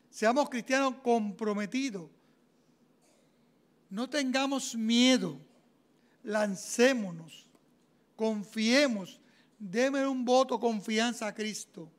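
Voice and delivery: slow, urging each word; slow exhortation. Real, not acted. Preacher urging his church, slow and firm. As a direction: call them on, one word at a time.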